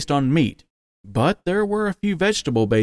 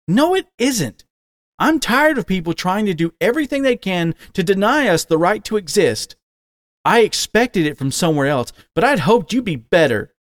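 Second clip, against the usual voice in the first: indignant outburst; 7 semitones higher than usual. A narrator's reading. Son confronting his father at the table, angry and raised. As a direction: let the hurt spill out as fury.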